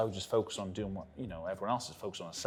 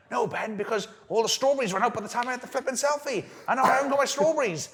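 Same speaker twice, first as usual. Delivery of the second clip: higher, louder, faster indignant friend; upset Elliot ranting; 13 semitones higher, 6 dB louder than usual. Retold or imitated. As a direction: complain like a wronged man-child. Elliot ranting about lost strawberries.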